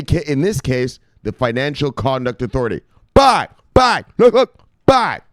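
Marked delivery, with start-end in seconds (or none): 3.1-5.2 high, loud bursts of celebration; hyped catchphrase shout. Real, not acted. Streamer hyping viewers on the news, high excited shouting. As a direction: yelp each word high and loud.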